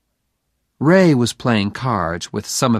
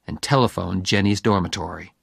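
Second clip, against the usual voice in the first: usual speaking voice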